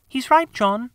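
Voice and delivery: put-on high girl voice, firm; a sister settling the matter; young girl. Wendy tells John he is right, high girl voice.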